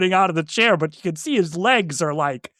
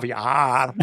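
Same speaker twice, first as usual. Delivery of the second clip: monster voice; 4 semitones lower than usual. Retold or imitated. Hosts voicing the monster eating.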